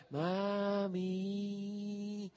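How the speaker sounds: soft pleading mock whimper of mami; manipulative child's voice. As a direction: coax the mother, small and pitiful. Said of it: "la voz del muchacho era".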